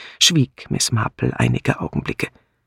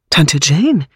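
higher, louder call of alarm; anxious call; 3 semitones higher, 6 dB louder than usual. A narrator's reading. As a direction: call out to your aunt, worried. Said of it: "rief Bunch ängstlich". (ängstlich gerufen)

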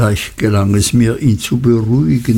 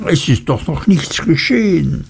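higher, oily cringing clerk; slippery voice backing down; placating Uriah; 5 semitones higher than usual. Acted. Clerk soothing his master, higher oily cringe.

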